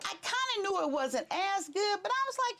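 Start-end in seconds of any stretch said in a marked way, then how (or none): none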